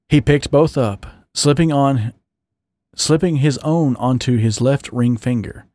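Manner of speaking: plain reading voice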